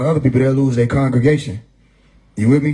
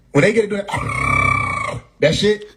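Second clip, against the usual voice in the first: high mock wail; 8 semitones higher, slower than usual. Mocking high church sounds.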